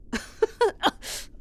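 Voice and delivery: airy amused chuckle; a quick breathy laugh; short laugh. Acted. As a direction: laugh at their cluelessness. Amused at listener's ignorance.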